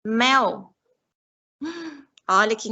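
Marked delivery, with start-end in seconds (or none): 0.0-0.7 MEL set apart as one clear beat; single-syllable demo. Shown as a demonstration. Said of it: "palavras que só têm uma sílaba".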